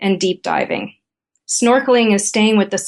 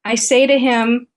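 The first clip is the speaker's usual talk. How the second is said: usual speaking voice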